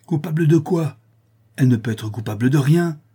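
high, loud, outraged question; indignant protest. A narrator's reading. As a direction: defend her hotly. Father indignantly rejects daughter's guilt.

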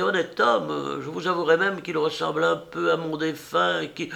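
high, sweetly mock-polite old woman; old enameller woman. Old woman introducing herself to the painter, high and coy. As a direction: play the coy old artist handing over her card.

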